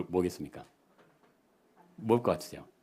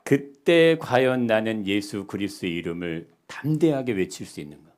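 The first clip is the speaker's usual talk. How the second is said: ordinary delivery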